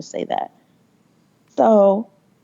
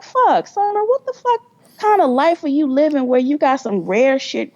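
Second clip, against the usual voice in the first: much higher, incredulous scolding of herself; high, mock-frustrated inner voice; exasperated self-talk; 7 semitones higher than usual. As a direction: chew yourself out in disbelief. Retold scolding herself in thought.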